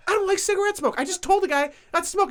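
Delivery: high, exasperated snob imitation; indignant older man. Older man complaining to the second smoker, exasperated. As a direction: complain way up high, acting fed up.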